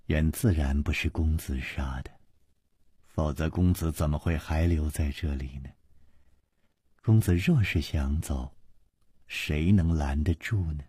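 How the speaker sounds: soft, tender reassuring voice; soft-spoken. A narrator's reading. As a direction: gently reassure him, quietly. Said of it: "王妃柔声说".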